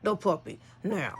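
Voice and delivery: soft, controlled order to a dog. Retold or imitated. Owner ordering the dog off a puppy, restrained warning.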